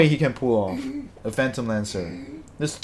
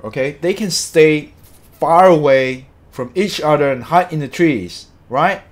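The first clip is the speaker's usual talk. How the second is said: loud, exasperated ranting with hard-hit words; real angry rant; 3 semitones higher, 6 dB louder than usual. Real, not acted. Streamer fuming over a bad hero draft, angry. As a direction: rant it out loudly, fed up. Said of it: "Sorry, I'm so angry".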